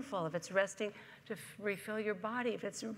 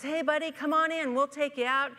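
loud, higher friendly call across water; 7 semitones higher, 10 dB louder than usual. Acted. Motorboat driver calling the stranded man aboard, loud and high. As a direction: shout a cheerful offer to climb aboard.

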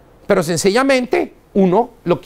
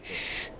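soft air hiss; 18 dB quieter than usual. Shown as a demonstration. Imitates soft air syringe.

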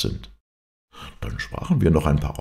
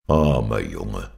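a hint of a lower, slow grumble; lightly voiced, lower, slow pitying grumble; grumbling; 5 semitones lower, slower than usual. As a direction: mutter it low and slow like a sceptical old friend. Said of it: "brummte der Freund".

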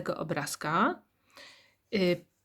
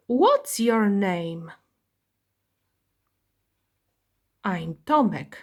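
slow, clear question-and-answer drill; teacher reading textbook dialogue to young pupils; slow model dialogue; much slower than usual. Shown as a demonstration. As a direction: read each line slowly for beginners to copy. Teacher reads model dialogues slowly.

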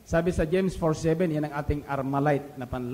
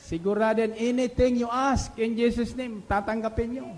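fervent preaching; 4 semitones higher than usual. Acted. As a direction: drive the promise home to the congregation. Preaching at full force.

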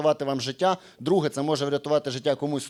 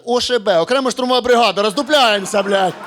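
loud, higher barking at a recruit; mock army shout; 4 semitones higher, 5 dB louder than usual. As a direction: snap it like a drill sergeant. Окулістка кричить на призовника. (гучний армійський окрик)

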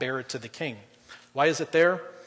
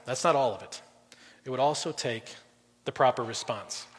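ordinary talk

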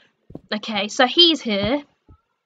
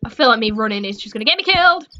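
ordinary talk, nothing marked

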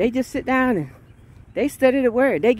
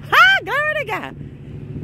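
ecstatic exclamation; 3 dB louder, slower than usual. Real, not acted. Preacher in the spirit, high soaring praise. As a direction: float it up high.

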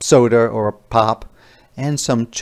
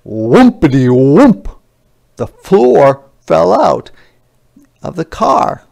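dramatic reveal; 3 semitones higher, 8 dB louder than usual. Acted. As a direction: make the crash a big moment. Floor drops out dramatic.

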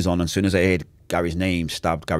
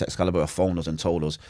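ordinary talk, nothing marked

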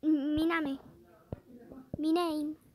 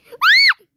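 sudden high-pitched scream of fright; startled scream; 19 dB louder than usual. Real, not acted. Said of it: "Perdón por gritar". (grito de susto)

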